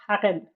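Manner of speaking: Haren with a rasped throaty G start. Shown as a demonstration. Streamer sounding out a Spanish name, throaty G. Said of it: "It's like a G sound".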